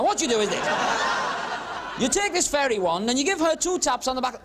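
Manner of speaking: ordinary talk, nothing marked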